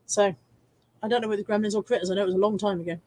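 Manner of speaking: usual speaking voice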